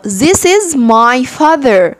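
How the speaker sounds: very slow, carefully stretched English; نطق بطيء جدًا. Shown as a demonstration. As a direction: stretch every word for beginners. Teacher models English sentence. (قراءة إنجليزي بطيئة جدًا)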